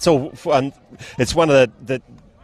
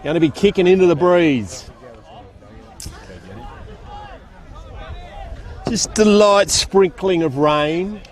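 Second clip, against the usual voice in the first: brisk live football call from the box; play-by-play commentary; 3 semitones higher than usual. Acted. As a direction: call the game as it unfolds, rising with the play.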